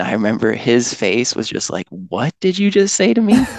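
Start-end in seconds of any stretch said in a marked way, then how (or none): 1.9-3.5 high, incredulous question; baffled. Retold or imitated. Rescuer baffled by an odd joke, high and incredulous. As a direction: ask it like you can't believe what you heard.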